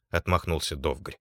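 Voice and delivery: plain reading voice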